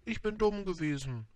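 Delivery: high-pitched flower voice; high voice of a penitent flower; proud flower, apologetic. A narrator's reading. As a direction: confess it in a high, delicate character voice.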